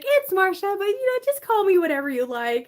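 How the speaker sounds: high sweet polite correction; imitating Marcia. Marcia politely correcting her name, high and sweet. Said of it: "and she's like".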